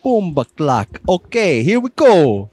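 loud, high, hammed-up Jamaican; booming put-on Jamaican accent. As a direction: psych yourself up in a mock Jamaican accent. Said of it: "so, uh, Jamaican".

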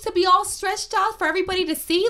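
high mocking voice; high, scoffing put-on voice; mocking friend voice. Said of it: "And I'm like".

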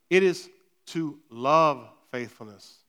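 "love" set apart and drawn out slowly; stress on LOVE. Shown as a demonstration. Preacher pointing the congregation to the verse's key word, stress on "love". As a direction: lean on the word "love", stretching it. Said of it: "Emphasis on the word love".

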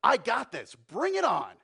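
loud, high, brash challenge; bold Peter. Acted. Peter tells God to bring it on, loud and brash. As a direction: Peter throwing down the gauntlet. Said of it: "and says".

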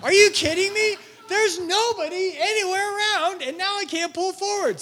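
high, loud incredulous rant, played for laughs; a preacher mocking his own parking-lot rage; exasperated outburst. Pastor re-enacts his high, loud disbelief at a blocking car. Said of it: "I was like".

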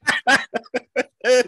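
high, loud imitated cackle of laughter; mock burst of laughter. Imitating the interpreter cracking up, high and loud. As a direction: let a shocked laugh burst out.

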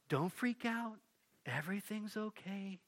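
soft soothing angel voice; quiet, calm angel. Acted. Angel greeting Mary, very quiet and calm. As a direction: hush them gently like a calm angel. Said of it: "Very quiet, very calm".

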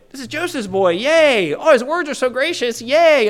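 high, cheery hammed-up cheering; mock-excited townsfolk. Townsfolk cheering the hometown boy, high mock excitement. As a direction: cheer him on like proud neighbors. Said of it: "So at first, they're excited".

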